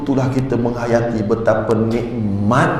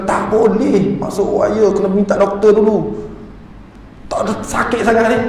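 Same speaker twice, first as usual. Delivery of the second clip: higher-pitched, insistent mock-nurse refusal; lightly imitated nurse; 7 semitones higher than usual. Meniru jururawat yang menolak permintaan. (meniru jururawat menolak, nada tinggi)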